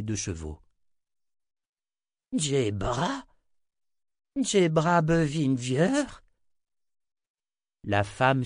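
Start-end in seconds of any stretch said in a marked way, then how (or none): 2.4-6.2 high, rising, drawn-out question; amazed recognition. A narrator's reading. Old wizard recognises the woman, amazed. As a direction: stretch the name out in delighted disbelief.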